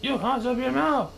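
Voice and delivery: high, angry royal howl; howled rebuke. Acted. Yertle shouting down at Mac, high angry howl. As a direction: shut him up furiously. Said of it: "howled the mighty King Yertle".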